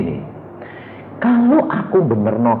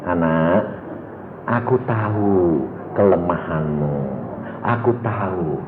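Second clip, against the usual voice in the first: voice of God; 11 semitones lower than usual. Acted. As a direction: speak as a tender God, deep and unhurried. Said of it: "Ngomongnya bagaimana?".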